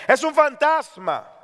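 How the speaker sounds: high, drawn-out cry of alarm; frightened cry. Acted. Preacher voicing disciples who see a ghost, frightened cry. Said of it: "de miedo se pusieron a gritar".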